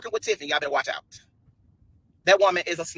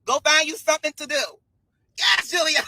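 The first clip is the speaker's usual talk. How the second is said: usual speaking voice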